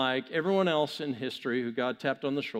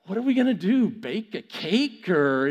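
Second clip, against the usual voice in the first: sarcastic disbelief; 4 semitones higher, 5 dB louder than usual. Acted. Gideon hearing of clay pots and torches, sarcastic. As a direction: query it with mock incredulity. Mocking God's odd equipment list.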